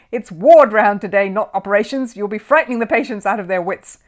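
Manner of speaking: loud, stern senior surgeon; stern scolding. A narrator's reading. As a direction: scold the odd student sharply. Sir Archibald scolds.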